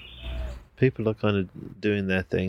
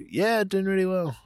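much higher, bright overdone cheer; fake cheerful; 12 semitones higher than usual. Telling his personal trainer he's doing great, falsely cheerful. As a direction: sell the fib with sing-song breeziness.

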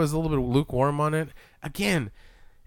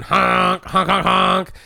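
higher, louder honk imitation; car horn honks; 6 semitones higher, 5 dB louder, much slower than usual. Imitating the Death Proof car horn.

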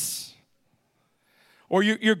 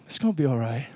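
low, soft, unhurried comfort; calm reassurance; 11 semitones lower, 4 dB quieter, faster than usual. Retold or imitated. Jesus calmly reassuring the panicking believers, low soft comfort. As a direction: settle them gently. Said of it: "And he's going".

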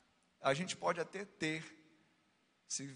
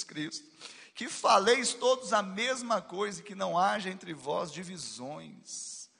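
loud scripture proclamation; preaching scripture reading; 4 semitones higher, 8 dB louder than usual. Acted. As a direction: proclaim the verse with fervour. Reads the scripture.

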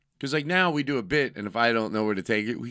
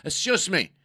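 indignant guard; 7 semitones higher than usual. Retold or imitated. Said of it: "security guy".

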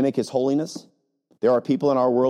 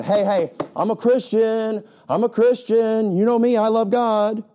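high, louder, slow insistent mock pleading; 9 semitones higher, 4 dB louder, slower than usual. Pastor mocking self-proclaimed Christians, high insistent. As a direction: plead it like a show-off believer. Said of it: "These are people that are going".

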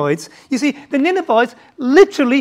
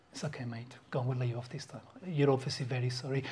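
low, soft, offhand judge voice; casual lenient judge; 10 semitones lower, 18 dB quieter, faster than usual. Acted. Judge dismissing a murderer, soft and offhand. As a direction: murmur it like a careless judge letting a killer go.

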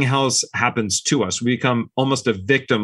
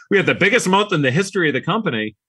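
fast, higher, still laughing; laughing excitement; 8 semitones higher, faster than usual. Real, not acted. Founder laughing at the record month he missed, fast and excited. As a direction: rush it out through a real laugh, higher.

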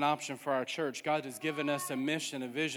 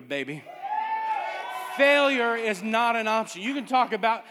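loud, rising preacher's cry; full force preaching; 4 semitones higher, 4 dB louder than usual. Acted. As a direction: preach it out to the congregation.

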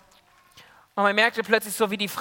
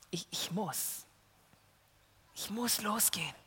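very soft, barely-there determination; Anna's whispered-down courage as she decides to go; hushed resolve; 14 dB quieter than usual. Acted. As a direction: say it to yourself so nobody hears. Frightened Anna steels herself quietly.

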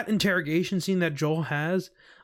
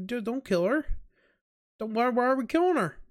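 retold protest; 6 semitones higher than usual. Acts own protest at Ellie's fate.

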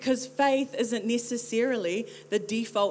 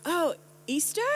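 puzzled "oh", pitched up into a question; mishearing a name; 6 semitones higher than usual. Retold or imitated. American mishears her name, puzzled question. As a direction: try out the wrong name, higher and unsure. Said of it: "And they were like".

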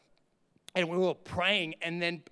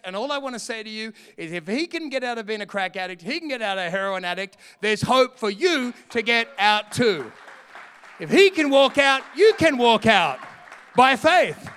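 building to a full shout, much louder; preaching shout; 3 semitones higher, 10 dB louder than usual. Acted. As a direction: cry it out to the crowd, rising to the peak. Climax of the sermon point.

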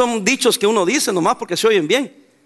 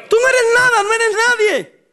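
shouted rebuke; 11 semitones higher than usual. Real, not acted. Preacher rebukes a proud unbeliever before the congregation, shouted rebuke. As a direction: cry it out to cut the listener down.

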